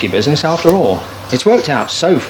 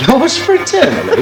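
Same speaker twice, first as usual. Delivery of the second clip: high, slowed, disbelieving repeat; can't believe anyone signs off like that; incredulous echo; 11 semitones higher, slower than usual. Acted. Husband echoing the letter's sign-off, slow incredulous.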